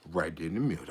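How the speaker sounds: very soft, low aside; acting out a quiet, low-voiced tip; barely voiced, deep and soft; hushed instruction. Quiet instruction repeated in the story.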